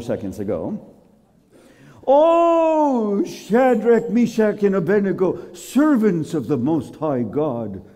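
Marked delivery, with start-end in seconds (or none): 2.1-7.8 high, drawn-out opening call; king calling out. Acted. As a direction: summon them from afar. King calls into the furnace.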